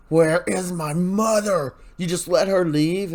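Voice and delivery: a recapper's mock of an alarmed daughter; upset demand. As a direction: voice the frantic daughter confronting him. Daughter confronts EJ over mother.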